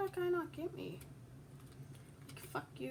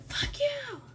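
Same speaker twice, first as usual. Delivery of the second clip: whispered curse; 12 semitones higher, 4 dB louder than usual. Real, not acted. After screaming.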